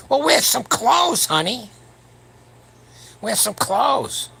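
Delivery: high, slow, loud sassy scold; sarcastic high scolding advice; mock scolding. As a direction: wag a finger at them, drawn out slowly. Scolding gym women, mock lecture.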